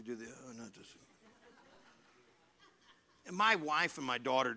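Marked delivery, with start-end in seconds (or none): 0.0-1.0 very soft fed-up sigh with a laugh; eye-rolling groan. Shown as a demonstration. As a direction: demo the eye roll for the congregation. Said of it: "Do this with me".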